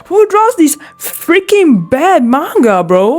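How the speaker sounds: high, slow disbelieving rant; incredulous rant. Real, not acted. Ranting at the manga, high slow disbelief. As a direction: stretch it out in disbelief. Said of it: "ranting at the manga".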